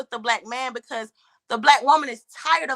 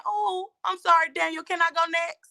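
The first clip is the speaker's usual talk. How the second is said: mock polite; 6 semitones higher, 5 dB quieter than usual. Guest showing host what men won't understand, mock polite. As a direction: put on a meek, over-polite little plea.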